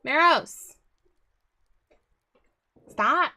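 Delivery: loud sharp yell at the cat; sharp shout. Real, not acted. Shouting at the cat to stop it interrupting, loud and sharp. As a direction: bark the cat's name to stop it.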